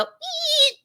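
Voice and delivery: high little startled eep; tiny squeak. Retold or imitated. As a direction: squeak it out like a scared kid. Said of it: "You get this little kid voice".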